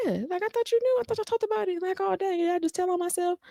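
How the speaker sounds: put-on high, soft, innocent girlish voice; airy girlish voice. Wife casually admitting something, high soft girlish voice. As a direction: play the oblivious wife, light and high. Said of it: "And she was like".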